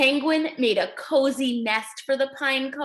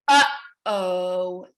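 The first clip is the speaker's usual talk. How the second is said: worried uh-oh; 7 semitones lower than usual. Acted. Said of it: "thought Penguin".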